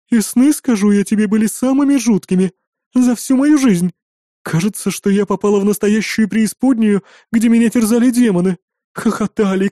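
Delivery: high, forceful character voice; worked-up hostess sharing her fright; innkeeper recounting nightmares. A narrator's reading. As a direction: tell a horror with agitated emphasis.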